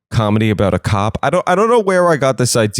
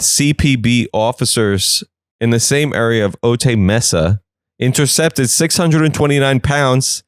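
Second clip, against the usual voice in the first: ordinary delivery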